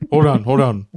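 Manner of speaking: ordinary delivery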